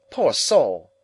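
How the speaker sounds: loud, affected mock pity; Camilla pitying. A narrator's reading. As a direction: sigh it out like a self-pitying gossip. Camilla character voice.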